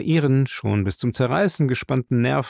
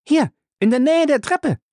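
high, loud calling out; final girl; 5 dB louder than usual. Acted. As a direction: call out bravely in the dark. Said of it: "rief sie".

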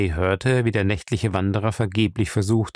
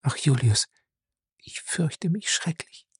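trembling whisper; 5 semitones higher, 4 dB quieter than usual. Acted. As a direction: barely breathe the words, scared. Frightened wife at night.

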